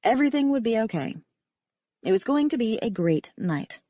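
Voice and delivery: plain reading voice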